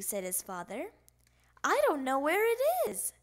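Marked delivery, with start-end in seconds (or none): 1.6-3.1 high, loud complaint; little frog at a loss; childish protest. A narrator's reading. Child protests about lost peaches.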